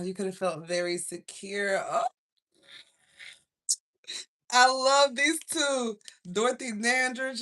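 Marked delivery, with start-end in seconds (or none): 4.5-7.4 gushing. Real, not acted. Gushing over movie couple.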